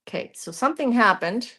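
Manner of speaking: usual speaking voice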